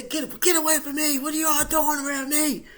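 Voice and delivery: high loud panicked yell; dazed yelling. Retold or imitated. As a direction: yell at strangers crowding you. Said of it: "And I'm yelling".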